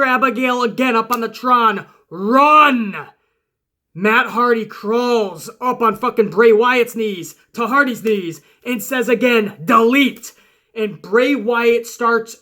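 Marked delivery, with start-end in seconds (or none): 2.1-2.9 high, loud warning shout; commanding shout. Retold or imitated. Sister Abigail warns Hardy from the Tron, loud shout. 9.6-10.3 defiant yell. Retold or imitated. Hardy defies Wyatt again.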